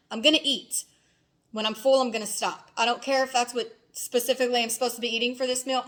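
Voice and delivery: ordinary delivery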